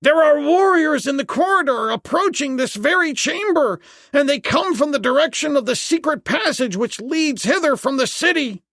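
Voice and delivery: very high, panicked warning; frightened priest. A narrator's reading. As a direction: keep the alarm high and urgent all through. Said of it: "A frightened priest".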